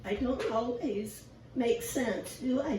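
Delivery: ordinary talk, nothing marked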